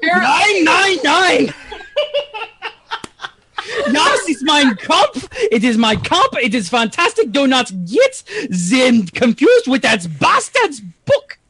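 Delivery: hammed-up German accent. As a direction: rant with a thick German accent. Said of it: "German Cthulhu, I guess?".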